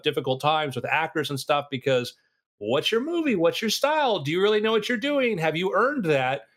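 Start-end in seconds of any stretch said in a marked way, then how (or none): 2.6-6.5 high-pitched sceptical questioning; mock-interrogate, pitch raised then settling at the end; acted challenge. Actors doubting an unproven director, mock challenge.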